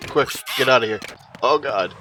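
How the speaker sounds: usual speaking voice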